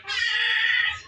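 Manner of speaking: high, drawn-out fake bawl; hammed-up crybaby wail; mock crying wail. Mocking people crying at grown men's video, fake wail. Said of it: "This video made you cry?".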